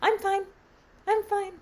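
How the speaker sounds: put-on a toddler bouncing up after a fall; cheerful little girl. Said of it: "She just went".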